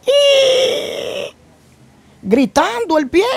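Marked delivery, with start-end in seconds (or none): 0.1-1.3 very high-pitched drawn-out squeal; imitated scream. Testimony teller voicing his wounded foot screaming, high mock scream. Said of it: "está gritando".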